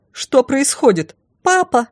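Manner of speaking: high-pitched anxious call; startled calling out; alarmed cry. A narrator's reading. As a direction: cry out high, demanding an answer. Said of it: "крикнула прибежавшая на шум королева".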